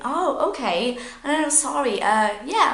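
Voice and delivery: high, hesitant backtracking; flustered apology. Acted. Worker correcting a misheard greeting, flustered. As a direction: scramble to apologise, mock nervous. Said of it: "happens when you're nervous".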